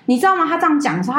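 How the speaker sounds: ordinary talk, nothing marked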